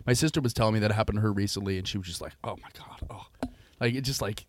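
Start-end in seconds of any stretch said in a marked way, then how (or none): 2.4-3.5 very soft, whispered mock dismay; hushed, weary imitation of disgust; disgusted groan. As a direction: imitate a sister's appalled sigh under the breath. Imitating sister's disgusted reaction.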